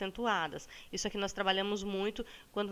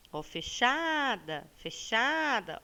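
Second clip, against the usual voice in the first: "fechada" drawn out slowly on its middle syllable; calling the word; 3 semitones higher, much slower than usual. Shown as a demonstration. Teacher points out the stressed syllable, calling "fechada" with long CHA. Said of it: "Olha a sílaba tônica".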